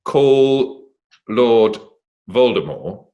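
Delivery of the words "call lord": ordinary talk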